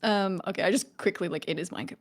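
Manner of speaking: ordinary talk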